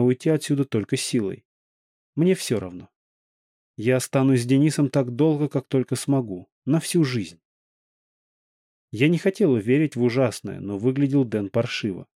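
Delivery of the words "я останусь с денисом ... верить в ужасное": ordinary delivery, nothing marked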